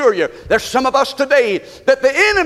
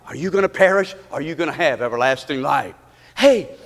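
ordinary delivery

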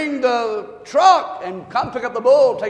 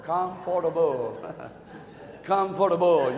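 each word stretched out slowly; drawn-out sleepy comfortable; slow drawn-out reading; 4 semitones lower, 6 dB quieter than usual. Retold or imitated. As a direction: read it real slow, savoring it. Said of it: "She'll read this word real slow".

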